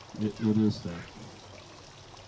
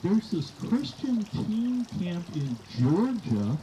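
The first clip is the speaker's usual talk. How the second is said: daughter voice; 9 semitones higher than usual. Retold or imitated. Daughter's voice quoted, high.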